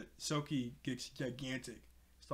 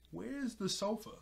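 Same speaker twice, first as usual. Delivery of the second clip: higher-pitched mock bafflement; lightly acted wondering, pitched up; puzzled question; 7 semitones higher than usual. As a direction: imitate someone baffled by something missing. Robin puzzled, retold.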